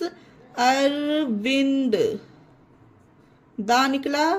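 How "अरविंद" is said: अरविंद drawn out very slowly, ending in dental द; slow clear pronunciation. Shown as a demonstration. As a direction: stretch out अरविंद syllable by syllable for learners. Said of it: "क्या पढ़ेंगे इसे friends". (अरविंद को बहुत धीरे, साफ़ बोलिए)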